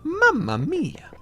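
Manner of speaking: high, slow Italian cartoon accent; over-the-top Italian accent, high and stretched; Mario impression. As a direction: ham up a bouncy Italian plumber voice. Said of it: "that's what Mario sounds like".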